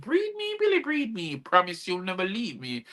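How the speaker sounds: very high mock moaning plea; breathy pleading. Helper cooing to Billy in bed, mock moaning. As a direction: swoon it up high. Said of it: "she was like".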